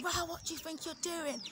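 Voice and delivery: high, mock-outraged voice; put-on road-rage rant, pitched right up; imagined angry driver. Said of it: "instead of him going".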